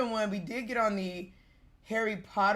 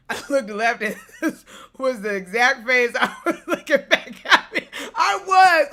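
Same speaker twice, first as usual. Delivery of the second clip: laughing; 2 semitones higher, 7 dB louder than usual. Real, not acted. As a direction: crack up at the ride photo. Seeing her own ride photo.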